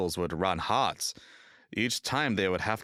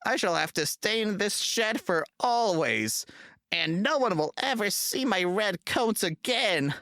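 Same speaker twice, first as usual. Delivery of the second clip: higher, whimpering self-pity; sad engine; 10 semitones higher than usual. A narrator's reading. James in the shed, sorry for himself, sadly wailing. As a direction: near tears, much higher and wailing. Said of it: "he thought sadly".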